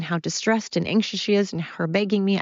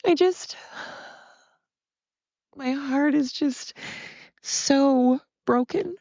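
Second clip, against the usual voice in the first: real tearful voice, much higher and halting; crying; 9 semitones higher than usual. Real, not acted. Daughter grieving her mom left alone, crying. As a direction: break into tears, pitch climbing, words coming slowly with long pauses. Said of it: "I'm so sorry that I'm crying".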